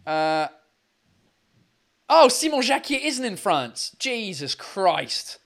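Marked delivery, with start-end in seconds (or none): none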